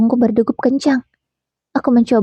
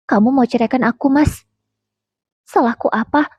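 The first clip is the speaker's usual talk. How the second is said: a lightly voiced tearful wife, pitch lifting; tearful question; 2 semitones higher than usual. A narrator's reading. Wife asking her husband why he wants divorce, mildly tearful. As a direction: ask through held-back tears, understated. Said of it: "dengan air mata yang sudah mengalir".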